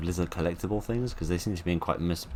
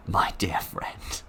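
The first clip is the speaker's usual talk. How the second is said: sinister whisper; much slower than usual. Pretend. Mock-sinister address to the mannequin.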